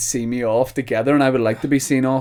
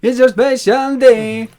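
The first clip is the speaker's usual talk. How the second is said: put-on Jamaican patois accent; 12 semitones higher, 7 dB louder, slower than usual. Joining the reggae bit.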